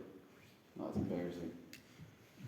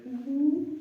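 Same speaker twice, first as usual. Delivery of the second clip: monkey hum; 14 semitones higher than usual. Retold or imitated. Monkey hears the peanuts.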